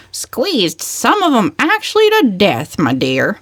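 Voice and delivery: put-on high, put-upon old-lady grievance; aggrieved complaint. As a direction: complain with high indignation, then sink on my dear. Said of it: "had a grievance".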